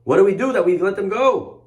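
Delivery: indignant mock disbelief, rising at the end; acted angry alarm, pitch climbing; imitated angry outburst. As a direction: demand it like a ruler suddenly regretting. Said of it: "Paro gets angry".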